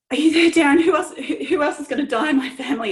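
imitated night-time panic; retold panic. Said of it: "and extreme panic".

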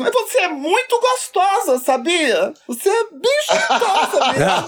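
high falsetto flirty woman; seductive woman voice. Retold or imitated. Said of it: "eu digo assim".

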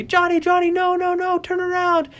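high, urgent sideline call; acted high-pitched coach's holler. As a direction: call frantically to a child running the wrong way. Coach calling out to child player.

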